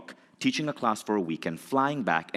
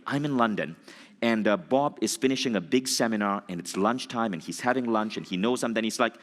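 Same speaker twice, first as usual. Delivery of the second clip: normal talking voice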